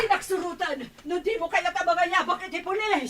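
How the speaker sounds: high-pitched defiance; defiant cry. Acted. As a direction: shoot back the threat of police. Quarrel, threatening police.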